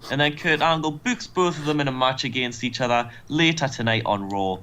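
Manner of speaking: normal talking voice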